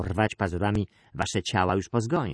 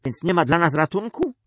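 high, louder desperate question; loud plea of a frightened son; crying out; 9 semitones higher, 6 dB louder than usual. A narrator's reading. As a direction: cry it out, rising into the question. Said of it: "Krzyknął Perilavos".

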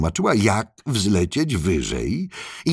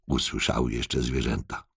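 ordinary delivery

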